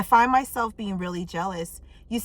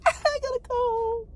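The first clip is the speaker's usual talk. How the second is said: high, slow, laughing sing-song. Pretend. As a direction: squeal it through a laugh. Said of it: "repeats it plainly".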